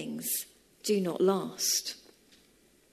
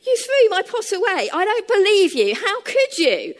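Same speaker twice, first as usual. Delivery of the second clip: higher, louder, upset and near tears; hurt and accusing, voice raised; tearful outrage; 11 semitones higher, 8 dB louder than usual. Retold or imitated. Liz confronting her fiancé, tearful and loud. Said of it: "eyes began to fill with tears".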